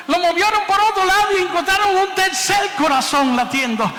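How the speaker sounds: high, loud rising announcement; excited shouting. Real, not acted. Preacher tells his radio listeners about the third heartbeat, shouting with excitement. Building to the quintuplets reveal.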